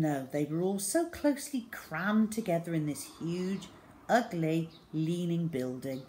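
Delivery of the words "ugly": ordinary delivery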